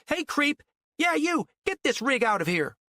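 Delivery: very high, hectoring yell; angry calling out. A narrator's reading. Mean mother chasing the flatbed, angry yell. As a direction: yell up at the driver to clear off. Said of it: "banging on the boards to get Cantwell's attention".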